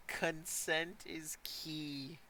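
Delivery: very slow and soft, drawn out; mock-solemn slogan. Echoing another player's lesson on restraint, mock-solemn. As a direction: stretch it out like a public-service message.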